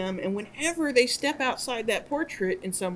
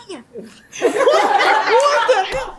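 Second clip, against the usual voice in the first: high, slow, laughing outburst of cursing; laughing cussing reporter; 11 semitones higher, 9 dB louder, much slower than usual. Retold or imitated. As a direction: blurt the curse through laughter. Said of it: "he went from his professional voice to, you know, cussing".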